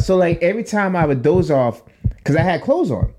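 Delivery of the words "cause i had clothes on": ordinary delivery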